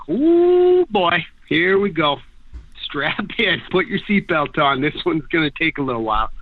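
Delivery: bracing for a wild ride, half joking; mock thrill. Acted thought before a sketchy run.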